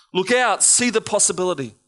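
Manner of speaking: normal talking voice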